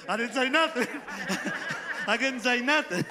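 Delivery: high laughing through the words; laughing in disbelief. Real, not acted. Preacher laughs recalling the miracle, laughing. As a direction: laugh it out, overwhelmed.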